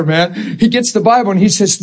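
ordinary talk

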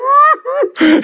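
high wailing "ooh"; hammed-up high sob-like ooh; imitated bawling. Telling how he bawled, high mock wail. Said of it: "I'm bawling".

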